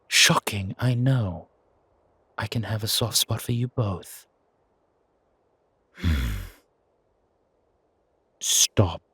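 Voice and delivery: a hint of a stern, teasing mother; elder mother figure. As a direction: chide the boy with amused authority. Mother figure scolding the wolf.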